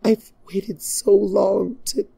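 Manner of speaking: high voice cracking mid-sentence; voice breaking. Acted. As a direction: let the voice give out. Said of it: "her voice broke".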